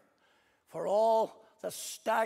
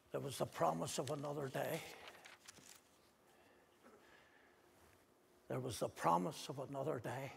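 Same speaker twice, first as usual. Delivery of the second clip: tender hush; 4 semitones lower, 7 dB quieter than usual. Acted. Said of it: "repeated line, softly".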